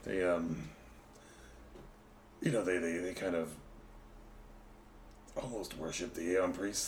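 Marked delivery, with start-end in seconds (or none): none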